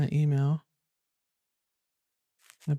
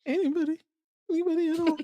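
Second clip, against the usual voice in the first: high, pleading, heartbroken call; betrayed and heartbroken; 11 semitones higher, faster than usual. Acted. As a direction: plead like nobody cares about you. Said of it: "Why do I sound so heartbroken".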